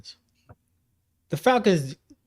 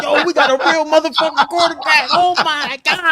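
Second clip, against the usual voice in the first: higher, louder, laughing disbelief; hammed-up fan cracking up with joy; mock giddy fan; 10 semitones higher, 6 dB louder than usual. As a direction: gush through laughter like you finally got lucky. Falcons fans rejoicing over quarterback.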